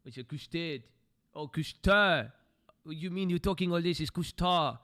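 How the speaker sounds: put-on "custard" said koos-tah in a light French accent; mock French accent. Mocking a French viewer scolding Americans, French accent. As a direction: play a light French speaker correcting Americans. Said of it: "He's French".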